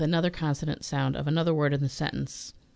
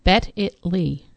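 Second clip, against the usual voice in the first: slow, louder repeat; slow and clear; 5 dB louder, slower than usual. Shown as a demonstration. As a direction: spell it out slowly for her. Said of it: "Say it again".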